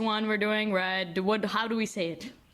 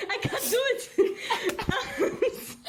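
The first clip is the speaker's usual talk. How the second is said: laughing; 14 semitones higher, much slower than usual. Real, not acted. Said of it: "I cannot do the fucking Russian accent".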